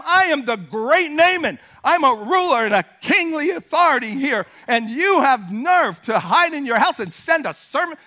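loud, offended self-important outburst; indignant anger. Acted. Great Naaman rebukes the man who sent a servant, indignant. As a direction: pull rank in an acted fury. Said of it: "He's mad. He's like".

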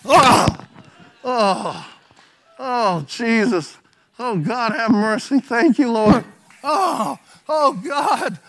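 heavy, exhausted moans of prayer; groaning at altar. Retold or imitated. Preacher re-enacts coming to the altar worn out, groaning. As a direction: collapse into groaned cries for mercy. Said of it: "I just went like this".

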